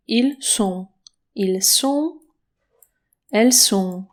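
"sont" with a voiceless s each time; separate, careful takes, s as in sun in "sont"; clear s sound. Shown as a demonstration. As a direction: model "Ils sont", "Elles sont" with a crisp s. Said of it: "Tutaj jest".

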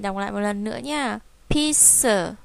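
usual speaking voice

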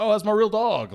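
high-pitched, proud dog owner; proud excitement. Retold or imitated. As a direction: gush proudly about your own pet. Acting proud dog owner's reply.

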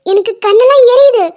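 high, loud cries for help with burning eyes; distressed shouting. Acted. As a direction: yell out in pain, calling for anyone. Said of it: "இவ வேற கத்திட்டே இருக்கா". (கண் எரிச்சலில் உதவி கேட்டு சத்தமாக அலறல்)

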